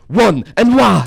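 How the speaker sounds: high, loud imitated holler; blurted mock yell. Woman answers at the same time as mate, mock yell. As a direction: blurt it over the friend's answer.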